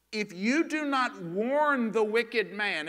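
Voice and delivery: slow, loud, emphatic preaching; scripture declamation. Real, not acted. Preacher quoting God's warning to the watchman, slow and forceful. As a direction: declaim it as a warning, leaning on each word.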